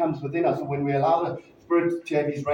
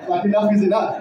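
laughing; 6 semitones higher than usual. Real, not acted. Laughing at the church welcome.

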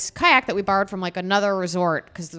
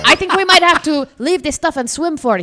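higher and louder, in a put-on Swedish accent; 7 semitones higher, 7 dB louder than usual. Retelling the Swedish guy's plan to swim ashore, in a Swedish accent. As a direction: imitate the Swedish kayak buddy's accent. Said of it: "Great accent".

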